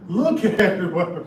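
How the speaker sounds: slow and loud, breaking into a laugh; laughing master call. Retold or imitated. Master calling botanists, cracking up while loudly calling out. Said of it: "that was funny".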